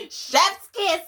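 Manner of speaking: high and loud, gushing with delight; excited gush. Real, not acted. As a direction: rave about it like it's delicious. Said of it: "excited gush".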